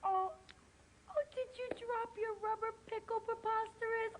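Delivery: high-pitched cooing sympathy; puppy baby talk. Acted. Woman sees the dog dropped its pickle, high cooing. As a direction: coo it as if to a baby.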